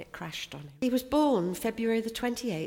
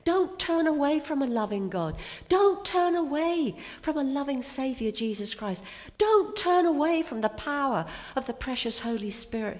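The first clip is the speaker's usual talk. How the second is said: higher, louder, pleading; fervent preaching; 8 semitones higher, 6 dB louder than usual. Acted. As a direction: urge them not to turn away.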